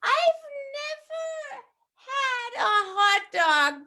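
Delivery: put-on high, slow duckling voice in an American accent; a small innocent bird asking; high curious duckling. Duckling asks pigeon.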